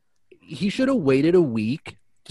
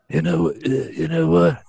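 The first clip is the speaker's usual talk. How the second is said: gravelly Ed Rendell impression; rough, gravelly old-politician voice; 4 semitones higher than usual. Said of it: "Is that how he talks?".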